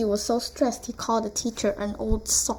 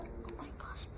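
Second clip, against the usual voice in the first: hushed, barely voiced; whispered aside; 18 dB quieter than usual. Real, not acted. Vlogger muttering about an old short, whispering.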